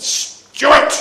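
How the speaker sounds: Jesus pulling Peter up by the hand; Jesus calling by name. Retold or imitated. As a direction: call his name to rouse him. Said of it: "He said".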